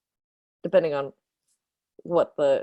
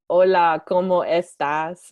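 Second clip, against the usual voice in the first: bad Spanish accent; 6 dB louder than usual. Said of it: "She has a really bad, like, Spanish accent".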